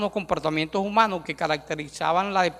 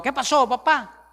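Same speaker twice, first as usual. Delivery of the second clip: imitando a un hijo que le grita a su papá, más agudo; hijo gritón; 6 semitones higher than usual. Retold or imitated. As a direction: burla de un hijo gallito de pelea. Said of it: "gritándole a los papás". (imitando a un hijo que le grita al papá, más agudo)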